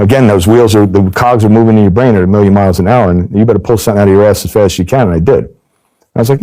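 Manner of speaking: usual speaking voice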